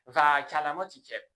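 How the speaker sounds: ordinary talk, nothing marked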